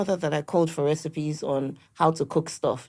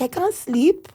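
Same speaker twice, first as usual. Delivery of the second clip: higher fretful mock complaint; imitating her anxious mother; 10 semitones higher, slower than usual. Daughter mimics her anxious mother waiting up, higher and fretful. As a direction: voice the sleepless worried grandma. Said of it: "She'll be like".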